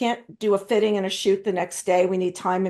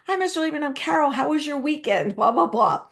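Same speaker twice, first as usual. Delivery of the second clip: bright, high eager hello to the boss; high, chipper hello; chirpy greeting; 6 semitones higher than usual. Retold or imitated. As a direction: greet him like an eager new girl.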